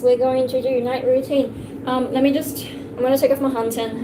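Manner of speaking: normal talking voice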